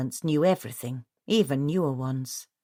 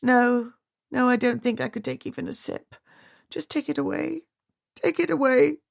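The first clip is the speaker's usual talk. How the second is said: distraught; 8 semitones higher than usual. A narrator's reading. Overwrought widow refusing tea.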